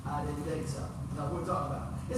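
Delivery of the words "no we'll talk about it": usual speaking voice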